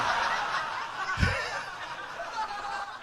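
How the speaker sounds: breathless, high-pitched laughter; real laughter taking over; high laughing fit. Real, not acted. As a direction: laugh helplessly at the punchline. Laughing at his own joke.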